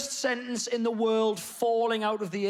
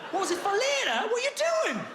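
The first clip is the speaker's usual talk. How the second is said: mock outrage; 13 semitones higher than usual. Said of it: "outraged rhetorical question".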